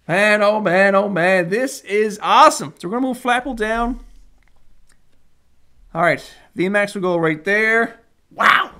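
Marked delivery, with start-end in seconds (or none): none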